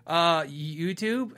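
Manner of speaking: slow, drawn-out unsure answer; stall, then offer it like a guess; retold sheepish hesitation; caught unprepared. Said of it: "I didn't know there was gonna be follow-up".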